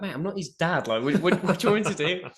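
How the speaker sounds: high-pitched retold protest, baffled; exasperated protest. Coach answering the ranting official, acted high exasperation.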